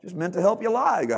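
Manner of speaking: normal talking voice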